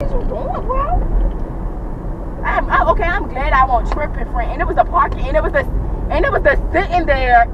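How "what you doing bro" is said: high incredulous question. Retold or imitated. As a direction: ask it high, baffled. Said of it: "I'm staring at him like".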